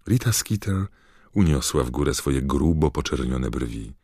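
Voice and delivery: ordinary talk, nothing marked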